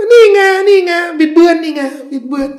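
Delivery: high, laughing mock accusation; mocking imitation. Teacher mocking opponents who claim distortion, laughing and high. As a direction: ham up a gleeful gotcha. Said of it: "ไอ้ชีอะห์เนี่ย".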